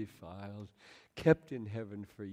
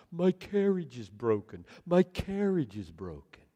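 louder, mildly hammed-up lament; mock blubbering; 3 semitones higher, 9 dB louder than usual. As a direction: wail half-heartedly like a foolish man. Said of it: "wringing his hands and blubbering".

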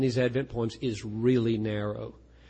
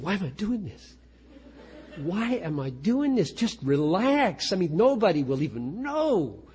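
exasperated inner voice; 6 semitones higher than usual. Retold or imitated. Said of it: "I sit there thinking".

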